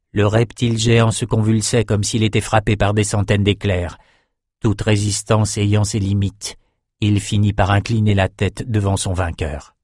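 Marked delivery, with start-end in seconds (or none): none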